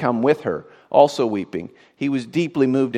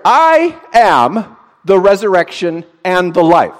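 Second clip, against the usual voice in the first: proclaiming Jesus; 7 semitones higher, 11 dB louder, slower than usual. Acted. Preacher proclaims Jesus' words.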